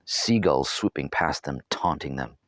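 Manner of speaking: ordinary delivery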